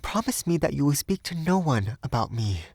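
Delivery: put-on gently pleading young woman; gentle. A narrator's reading. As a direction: make a gentle plea, pitched high. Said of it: "she said gently".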